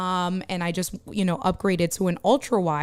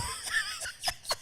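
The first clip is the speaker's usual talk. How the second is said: burst of laughter; 13 dB quieter than usual. Real, not acted. Laughs at Diana's noise-gate joke.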